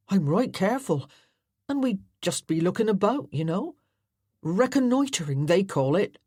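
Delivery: lightly voiced friendly country boy; an easygoing rustic lad, inviting; Tom, country lad. Tom persuading John.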